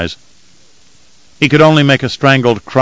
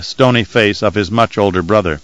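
ordinary delivery

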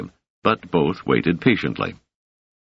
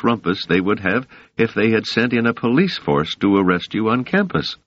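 higher, earnest concerned woman; Doris voice; 8 semitones higher than usual. Acted. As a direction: voice her higher, full of self-blame.

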